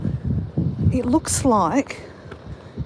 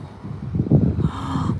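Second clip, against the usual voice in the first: awed gasp; 3 semitones higher than usual. Real, not acted. Vlogger spots the pretty rocks, awed gasp. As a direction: gasp at the view below. Sees view from cliff.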